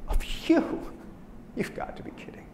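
incredulous high "you", then hushed scoff; scornful sheep. Acted. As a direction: scoff at the wolf, trailing into a whisper.

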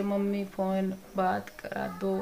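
put-on slow, halting, one word at a time like a sick child; softly halting imitation of a patient; slow broken words. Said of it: "धीरे धीरे एकदम।".

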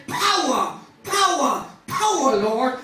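loud, escalating cries climbing high; preacher's repeated high shout; crying out. Acted. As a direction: call desperately on the Lord. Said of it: "I cried".

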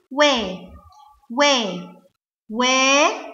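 "وا" repeated as separate wa syllables, with pauses; letter sound. Shown as a demonstration. Teacher models waw with fatha, "وا" as an open wa. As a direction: sound out "وا" as wa, not the letter's name. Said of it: "أنا هقول وا على طول".

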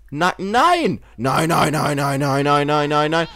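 exasperated yelling; frantic nos. Real, not acted. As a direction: shout at the screen to stop it. Frantic reaction to game character.

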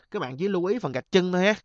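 ordinary talk

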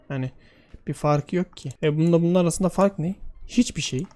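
normal talking voice

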